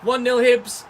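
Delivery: loud, high exasperated call; frustrated announcement. Real, not acted. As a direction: call out the bad score loudly. Conceded a goal.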